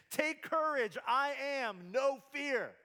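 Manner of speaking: high, loud declarative shout over the storm; Jesus yelling to disciples over the storm, loud and emphatic. Retold or imitated. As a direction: call it out over crashing waves. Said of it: "and he just yells to them".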